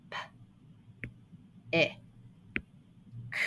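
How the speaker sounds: P, I, K as separate short sounds with long pauses; sounding out. Shown as a demonstration. Teacher counting the sounds in a syllable, sounding out. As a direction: break "P, I, K" into isolated phonemes, not letter names. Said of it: "How many sounds do we hear".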